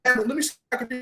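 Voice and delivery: high, whiny worried wife voice; complaining wife. Retold or imitated. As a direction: whine it like a wife scared of the storm. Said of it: "He said".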